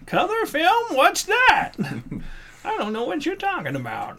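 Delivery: put-on high, puzzled old person voice; confused old-timer. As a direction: play a doddering old-timer.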